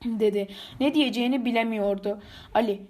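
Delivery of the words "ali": usual speaking voice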